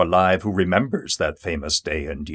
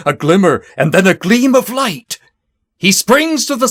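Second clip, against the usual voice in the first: excited; 9 semitones higher, 5 dB louder than usual. A narrator's reading. Signal lamp seen, rider mounts.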